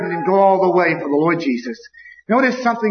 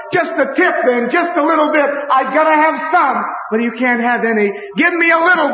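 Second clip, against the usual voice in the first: begging for a drop; 6 semitones higher than usual. Retold or imitated. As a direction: plead for just a drop. Voicing begging man in hell.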